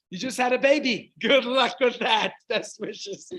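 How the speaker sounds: loud, high mocking exclamation; mock congratulations. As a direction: play up the absurd well-wishing. Mocking congratulations with 'good luck'.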